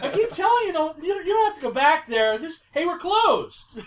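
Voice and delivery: high-pitched, loud, flustered protest; Scooter, loud and nervous. Acted. Scooter turns visitors away, loud and nervous. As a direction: push it high and loud, losing your cool. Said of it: "louder and louder and higher and higher voices".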